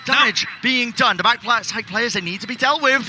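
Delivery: rising, fast hype call over the action; excited play-by-play. Real, not acted. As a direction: call the fight as it unfolds. Caster hyping a live fight.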